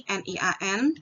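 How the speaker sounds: ordinary talk, nothing marked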